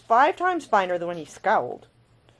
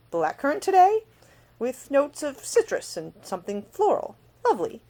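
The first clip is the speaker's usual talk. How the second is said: savouring appreciation; 8 semitones higher, 4 dB louder than usual. A narrator's reading. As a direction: savour the scent aloud. He savours her perfume.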